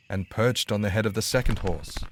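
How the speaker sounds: plain reading voice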